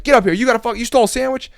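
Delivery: loud, fast, high-pitched bellow; acted furious shout; drill instructor berating. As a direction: imitate an outraged drill instructor chewing out a recruit.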